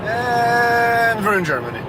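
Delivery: high, drawn-out arrival announcement; excited announcement. Real, not acted. Driver announcing the arrival in Germany, excited. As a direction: stretch out the first word, pitched high.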